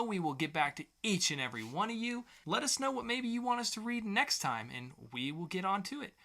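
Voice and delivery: ordinary talk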